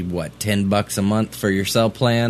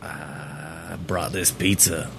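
low, slow, soft come-on; hammed-up pizza delivery seducer, drawn out; mock seduction; 4 semitones lower, 7 dB quieter, much slower than usual. Improvised porn come-on line.

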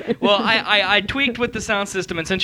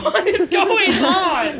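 high, laughing exclamation; laughing disbelief; 11 semitones higher, much slower than usual. Real, not acted. Host baffled by sudden singing, laughing disbelief. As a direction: cry it out through laughter, drawn out.

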